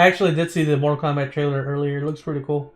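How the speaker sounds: usual speaking voice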